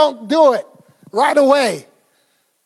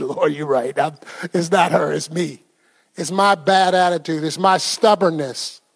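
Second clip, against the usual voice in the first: low, subdued admission; contrite confession; 6 semitones lower, 3 dB quieter than usual. Retold or imitated. Husband admitting to the Lord that he is the problem, contrite. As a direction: own up humbly in a lowered voice.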